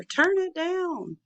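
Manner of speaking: high, slow hollered order; hollered command. Acted. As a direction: holler it over the music. Rex hollering at boombox.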